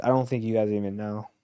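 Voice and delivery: ordinary delivery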